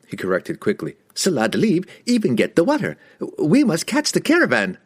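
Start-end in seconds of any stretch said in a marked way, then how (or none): 1.2-4.8 high, eager merchant voice; fawning, nervous trader. A narrator's reading.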